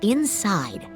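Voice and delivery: usual speaking voice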